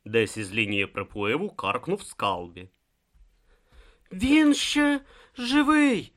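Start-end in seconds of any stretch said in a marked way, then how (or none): 4.1-6.1 high, slow strained voice; forced out. A narrator's reading. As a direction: squeeze the words out slowly with great effort. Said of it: "спромігся видушити з себе Брута".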